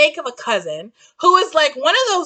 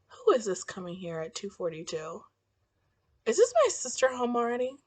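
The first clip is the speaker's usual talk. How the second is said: soft, low hushed aside; 7 semitones lower, 13 dB quieter than usual. Real, not acted. Wondering who is coming home, hushed and low. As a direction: whisper it so no one hears. Hearing someone arrive, hushed.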